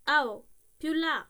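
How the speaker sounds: loud, slow, drawn-out Roman call. A narrator's reading. Shopkeeper calling the coachman, loud slow shout. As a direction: haul the words out across the street. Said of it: "chiamò, rivolto al cocchiere".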